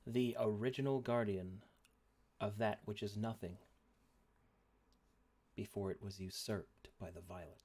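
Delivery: dramatic narration. Acted. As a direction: set the scene with gravity. GM narrating lore.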